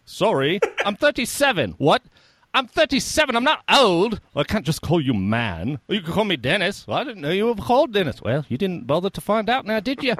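high, loud quoted sketch voices; Holy Grail peasant scene. Retold or imitated. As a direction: act out the peasant and king bickering. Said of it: "one of the things I quote".

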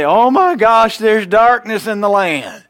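high, loud, slow alarmed wail; mock panic. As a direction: ham up a panicked cry. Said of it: "He didn't say".

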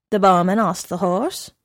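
plain reading voice

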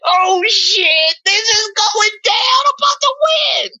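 high, rising excited shriek building to a shout; excited inner shout. Retold or imitated. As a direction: squeal with glee as if winning. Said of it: "I was like".

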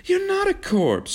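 very high cry of horror; horrified protest. A narrator's reading. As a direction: protest in horror, pitch soaring. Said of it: "she protested in horror".

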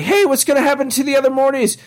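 high cartoon boy voice, asking; Morty. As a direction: pitch it way up like a cartoon kid. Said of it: "Morty's all like".

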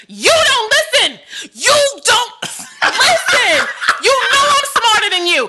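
high, drawn-out exasperated scolding; too excited. Real, not acted. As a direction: scold your partner word by word, pitched high. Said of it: "You're getting too excited".

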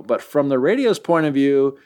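ordinary talk

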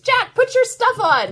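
put-on high little girl calling her brother; child calling. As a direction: call out to Jack like a bossy kid. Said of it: "child calling brother".

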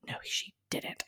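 breathless whisper of disbelief. Real, not acted. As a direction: hush it like a secret you can't believe. Shocked aside.

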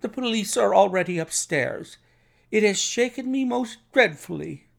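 high-pitched, agitated lament; shaken distress. A narrator's reading. Shaken doctor explaining police are upstairs, high agitated voice. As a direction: keep the voice high, badly rattled.

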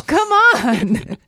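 high, loud whining plea; loud protest. Real, not acted. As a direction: beg loudly. Protesting no more bets.

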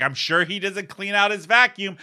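mock judging mom. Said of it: "she's like".

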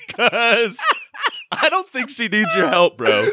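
high-pitched, cracking up while talking; helpless high giggle in the voice; laughing. Real, not acted. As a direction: squeeze the words out through laughter. Cracking up at own joke.

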